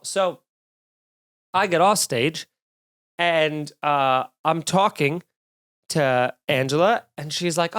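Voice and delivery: normal talking voice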